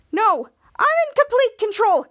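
high, loud, indignant insistence; acted angry denial. Henry denying to Charlotte that he's out of control, indignant. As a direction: insist heatedly, pitch jumping up.

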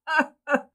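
short, high burst of mocking laughter; acted belly laugh, briefly done; roaring laughter. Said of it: "The colonel roared with laughter".

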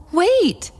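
high-pitched call, no added volume. A narrator's reading. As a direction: call after a friend in a high voice. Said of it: "a voice called out to Daisy".

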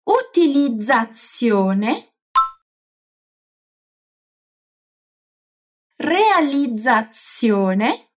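"Utilizzazione" very slow, dz then ts; slow syllable by syllable. Shown as a demonstration. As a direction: spell out "Urbanizzazione" slowly. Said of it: "repete comigo as outras palavras".

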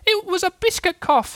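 very high squeaky little-creature voice; tiny, piping protest; Roo. Acted. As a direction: squeak an excuse like a small child. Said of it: "said Roo".

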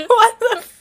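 surprised. Real, not acted. Incredulous outburst.

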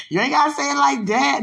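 loud, high mock-hurt whine; playful protest. Speaker playfully objects to being told no, mock-hurt protest. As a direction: whine back loudly in pretend offence. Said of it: "I'm just playing".

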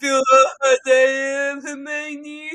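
loud, put-on parody voice; mocking imitation. Co-host mimicking reader's Harry, loud mocking voice. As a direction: send up his character voice.

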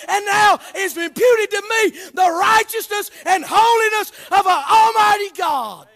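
building from measured to shouted preaching; fiery Baptist preacher shouting the gospel; preaching at full force. Acted. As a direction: whoop it up to the pulpit.

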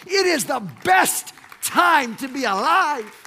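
loud, rising preacher climax; preaching at full force. Acted. Pastor lifting the congregation to a loud climax. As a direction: build the sermon to a shout. Sermon building to a peak.

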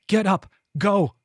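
high, sharp urgent command; urgent shout. A narrator's reading. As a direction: shout at them to move. Rajan rushes up urging them.

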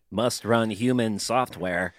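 slow, mechanical monotone; stilted android delivery; robot voice. Pretend. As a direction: play along as a computer reciting commands. Joins in the robot bit.

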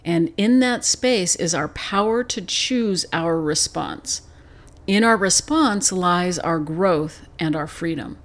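reading the quote slowly, weighing each phrase. Acted. Host slowly reads an opening quote to listeners. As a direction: let each line of the quote land slowly. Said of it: "The quote goes like this".

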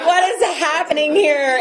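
lost among the strangers; baffled disbelief. Retold or imitated. Retelling her first AA meeting, high bewildered question.

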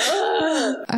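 high-pitched "ugh" of exasperation; exasperated groan. Real, not acted. Host venting about the chapter, exasperated groan.

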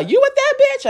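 very high mock outrage; imitating rival's cussing. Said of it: "And her".

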